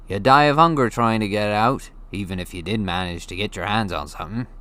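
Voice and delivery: gruff giant, telling a tale; Hagrid voice. Acted. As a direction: spin it like a big storyteller. Hagrid explains.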